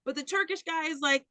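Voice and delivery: usual speaking voice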